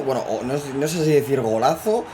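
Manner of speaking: ordinary talk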